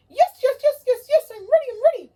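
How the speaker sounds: very high, loud, gushing owl; excited puppet owl. Acted. Owl puppet thrilled about the story, squealing. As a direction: squeal with joy over story time.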